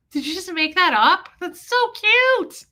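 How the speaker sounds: high, loud squeal rising into a drawn-out "cute"; reenacted adoring squeal; delighted gushing. Retelling delighted reaction to catchphrase.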